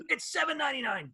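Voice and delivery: high, punchy announcer hype; obnoxious ad voice. Retold or imitated. Radio host mimics the record-store ad announcer, obnoxious. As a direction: bark the price like a pushy ad man. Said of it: "this obnoxious voice goes".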